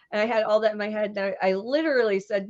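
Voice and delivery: ordinary delivery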